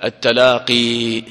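slow التلاقي with the final ي sounded; slow demo of التلاقي; slow, emphatic. Shown as a demonstration. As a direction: stretch التلاقي to show the ياء. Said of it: "أصلها إيش؟".

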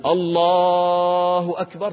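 "الله" held long on its second vowel, slow chant; short opening, long middle in "الله"; correct adhan lengthening. Shown as a demonstration. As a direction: chant "الله أكبر" stretching the "لا" in "الله". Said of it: "صح".

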